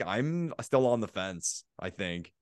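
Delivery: normal talking voice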